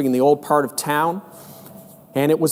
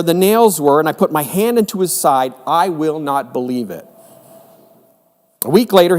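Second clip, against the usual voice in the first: scripture reading; 3 dB louder than usual. Acted. Reads John chapter twenty aloud.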